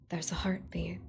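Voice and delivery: tense soft whisper; whispered narration. A narrator's reading. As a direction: whisper it, breathless.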